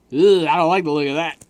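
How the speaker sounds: loud disgusted groan through a covered mic; muffled disgust. Real, not acted. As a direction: groan it out behind your hand. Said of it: "sorry if I sounded real muffled".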